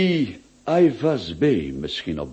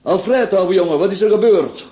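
raised, higher call across the café; calling out in alarm; 9 semitones higher, 4 dB louder than usual. Acted. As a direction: call to a shaken friend. Friend arrives looking shaken. (bezorgd roepen)

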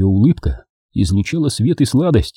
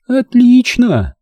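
higher, slow fond word; tender; 13 semitones higher, 4 dB louder, much slower than usual. A narrator's reading. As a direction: say it with motherly tenderness. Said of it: "произнесла она с нежностью". (с нежностью)

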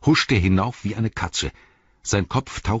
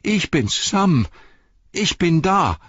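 higher and louder, close to tears; tearful reassurance; 11 semitones higher, 5 dB louder, slower than usual. A narrator's reading. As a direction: cry out that you're here, higher and louder. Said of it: "fast blind vor Tränen".

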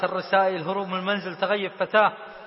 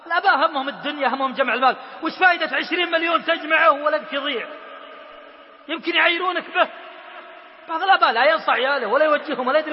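raised, urgent sermon voice, pressing each phrase; fervent preaching; 6 semitones higher, 4 dB louder than usual. Acted. Preacher warning young men about prison, passionate full-force sermon. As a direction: preach it hard to the crowd, driving every warning home.

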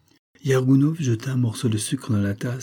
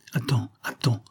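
hushed, half-whispered plea to wait; low-voiced; 2 semitones higher than usual. A narrator's reading. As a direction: hold him off under your breath. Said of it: "dit-elle à voix basse". (à voix basse, presque chuchoté)

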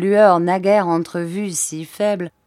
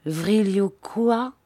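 slow baffled query; slowed, bewildered repeat; puzzled question; 3 semitones higher, slower than usual. Acted. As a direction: stumble over the strange word. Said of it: "cria Bobby d'un ton interrogateur".

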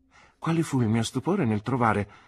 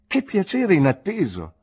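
much higher and louder, a glad cry; delighted exclamation; 10 semitones higher, 7 dB louder than usual. A narrator's reading. Nephew greeting his unexpected aunt, delighted cry. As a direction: burst out with happy surprise.

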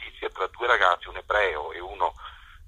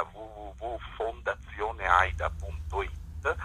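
slow dictation; 4 dB quieter, slower than usual. Real, not acted. Dictating web address on radio.

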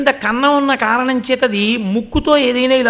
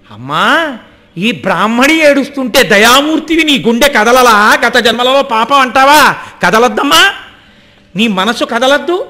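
louder pleading prayer to the mother goddess; fervent plea; 5 dB louder than usual. Acted. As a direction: implore her with rising force.

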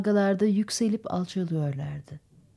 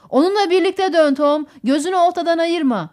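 raised, loud voice calling directions; urgent instruction; 6 semitones higher, 6 dB louder than usual. A narrator's reading. Roger tells Tom to turn with the fish, urgently. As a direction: call out orders across the boat.

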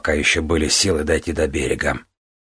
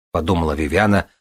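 plain reading voice

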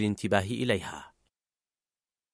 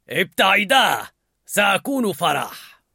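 louder, higher shout, slow; Anger shouting; 6 semitones higher, 3 dB louder, slower than usual. A narrator's reading. Anger climbing the console, shouting. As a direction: bark it, pushing them aside. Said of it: "فصاح".

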